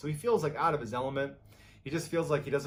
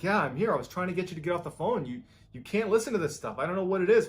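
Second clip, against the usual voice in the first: higher, urgent retold reply; agitated warning; 6 semitones higher, 5 dB louder, faster than usual. Bill urging his friend off the phone, agitated warning. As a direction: voice raised in insistent warning.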